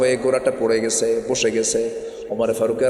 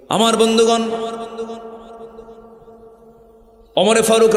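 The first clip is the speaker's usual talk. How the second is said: preaching at full force; 9 semitones higher than usual. Acted. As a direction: rise up and preach it hard. Preacher addresses friends, full force.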